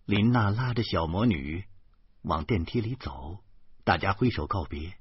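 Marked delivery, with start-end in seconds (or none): none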